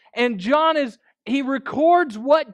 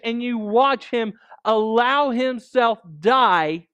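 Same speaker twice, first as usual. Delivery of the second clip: usual speaking voice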